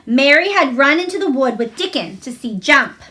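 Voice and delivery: usual speaking voice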